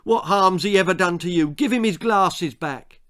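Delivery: high, loud furious telling-off; a girl shouting at the bully to stop; angry shout. Acted. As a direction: shout it right in his face. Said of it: "shouted in his face".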